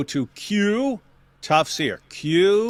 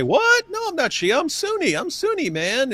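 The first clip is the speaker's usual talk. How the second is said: high mock denial. Acted Shia denying identity.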